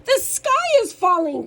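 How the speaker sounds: high, loud storybook alarm; hammed-up warning call; alarmed cry. A narrator's reading. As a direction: cry it out like a panicked hen in a kids' tale. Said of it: "cried Henny Penny and Ducky Lucky".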